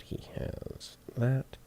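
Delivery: slow unvoiced whisper. Real, not acted. Engineer whispers his check to himself, slow and hushed. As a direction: breathe the words slowly.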